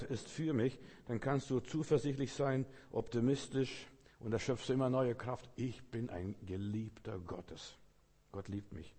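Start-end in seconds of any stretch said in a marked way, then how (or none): 5.6-8.9 soft, slow and lowered; quiet affirmation. Shown as a demonstration. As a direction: let it settle slowly, as personal reassurance. Returns to the soothing affirmation.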